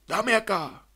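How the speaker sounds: a light hint of an impatient father; snatching the baby, impatient; gruff father. A narrator's reading. Father grabbing the baby, lightly impatient. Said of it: "Y él dijo".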